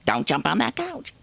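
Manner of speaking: lightly voiced, high-pitched mother's warning; high mock scolding. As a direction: imitate mom telling you off, pitch climbing. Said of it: "she told me not to do it".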